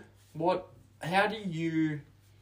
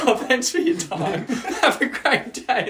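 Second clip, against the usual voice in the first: higher, louder, laughing cut-off; embarrassed laughing goodbye; 11 semitones higher, 9 dB louder than usual. Retold or imitated. Retelling hanging up on a client, laughing, higher and louder. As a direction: bail out of the call laughing. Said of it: "And I was like".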